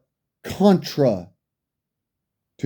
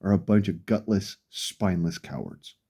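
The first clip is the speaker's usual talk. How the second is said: low, much softer, muttered insult; quiet contempt; 8 semitones lower, 8 dB quieter than usual. Real, not acted. Ranting at law-abiding citizens, quiet cold contempt. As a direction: let it sink to a cold near-mutter.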